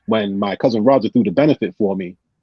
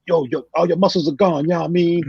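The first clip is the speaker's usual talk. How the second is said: higher teasing buddy voice; mock worried friend, pitched up; imitating Mike Lisbon; 7 semitones higher than usual. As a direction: rib him like a concerned old head. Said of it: "He goes".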